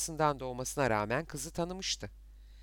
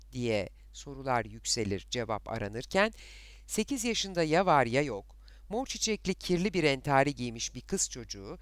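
ordinary delivery, nothing marked

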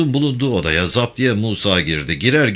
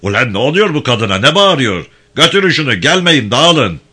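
higher, louder angry orders at the crowd; angry police chief; 6 semitones higher, 7 dB louder than usual. Acted. Police chief angrily ordering the villagers to disperse. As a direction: bark at the villagers to scatter. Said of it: "Zaptiye başı iyice kızdı".

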